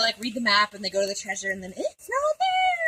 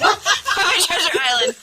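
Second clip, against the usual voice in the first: laughing; 9 semitones higher, 7 dB louder than usual. Real, not acted. Laughing correction.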